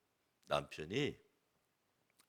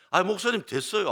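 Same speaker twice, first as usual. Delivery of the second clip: exasperated brush-off; 6 semitones higher, 8 dB louder than usual. Retold or imitated. Father brushing off pastor's advice.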